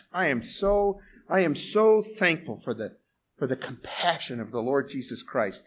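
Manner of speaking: ordinary delivery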